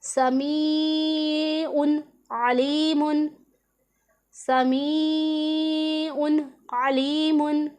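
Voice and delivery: very slow, melodic recitation of समीउन अलीमुन; Quranic recitation. Shown as a demonstration. Teacher reciting the tanween example slowly for her students. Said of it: "इसे हमने किस तरह पढ़ना है".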